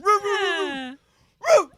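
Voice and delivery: very high howling bark; dog barking. Retold or imitated. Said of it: "He goes".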